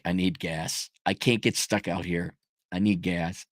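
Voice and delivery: quiet anxious prayer. Retold or imitated. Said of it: "I'm like".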